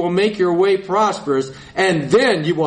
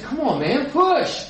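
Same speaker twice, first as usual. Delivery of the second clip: urging push; 7 semitones higher than usual. Retold or imitated. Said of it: "the guy next to you said".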